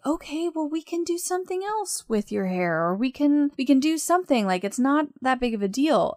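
high-pitched retold soothing, talking someone down; reassuring mom. Mom consoles her upset child on the bed, reassuring. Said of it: "my mom was there saying".

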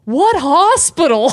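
high, drawn-out call from across the room; nicely called out. Retold or imitated. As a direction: imitate a heckler's innocent question, slowly. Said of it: "so nicely just goes".

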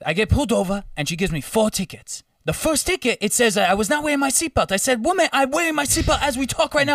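high, animated African driver impression; imitated African accent climbing high in outrage. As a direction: protest indignantly in a put-on African accent. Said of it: "I'm gonna do the accent".

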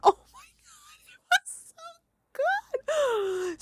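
very high, laughing and crying; laughing through tears. Real, not acted. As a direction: gush with real delight. Overwhelmed by the movie ending.

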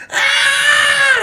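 high, loud imitated scream; girl's scream. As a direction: shriek like a scared girl. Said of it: "she's like".

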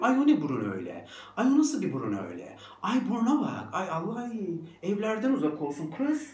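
quick, catty exclaiming over someone; hammed-up horrified fussing; mocking gossip. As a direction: imitate a scandalised gossip. Voices gossips mocking a nose.

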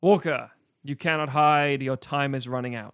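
loud, stiff robot command; metallic cyborg voice. Acted. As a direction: bark it like a killer android. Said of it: "calls out in a robotic voice".

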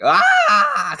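high, loud imitated scream; mock shrill scream; weird scream. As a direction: let out a Green Goblin old-lady shriek. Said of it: "he does a weird scream".

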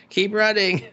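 high-pitched mock-frantic cry; frantic urging. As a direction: urge them to flee, voice jumping up. Acted urging to flee, escalated repeat.

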